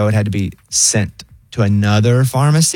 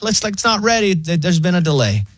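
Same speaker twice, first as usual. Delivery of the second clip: much higher, easygoing AI voice; lazy friendly machine offering help; chill robot voice; 7 semitones higher than usual. Retold or imitated. Pharmacy AI reporting a delay, high chill voice. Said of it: "And so they're like".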